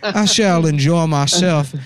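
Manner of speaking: slow, savoring Southern dandy drawl; hammed-up Southern dandy, stretching the words. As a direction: linger grandly like a Southern gentleman. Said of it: "My cool Southern dandy voice".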